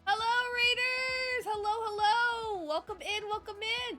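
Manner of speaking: bubbly high streamer greeting; sing-song welcome to raiders; cute anime streamer. Real, not acted. Streamer greets incoming raid.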